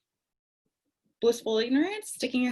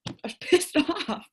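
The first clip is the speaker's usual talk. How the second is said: laughing; 6 semitones higher than usual. Real, not acted. Laughing at her own anger.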